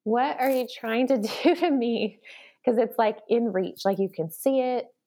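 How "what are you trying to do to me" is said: exasperated plea. Retold or imitated. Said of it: "I was like".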